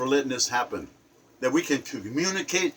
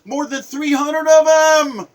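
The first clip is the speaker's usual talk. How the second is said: much higher, louder outrage; outraged shout; 8 semitones higher, 6 dB louder than usual. Real, not acted. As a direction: shout it out in disgust. Outraged rant about abuse.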